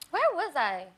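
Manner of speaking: ordinary talk, nothing marked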